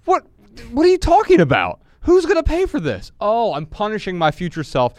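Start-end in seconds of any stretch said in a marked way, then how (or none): 0.0-3.1 high, loud disbelieving cry; incredulous outburst. Pretend. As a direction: squeal in mock disbelief. Imitates incredulous reaction, high pitched.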